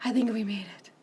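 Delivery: hushed pretend relief; relieved whisper. Teacher playing safe from the crocodile, whispered relief. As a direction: whisper it like you just escaped.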